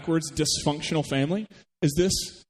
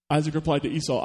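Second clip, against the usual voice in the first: ordinary delivery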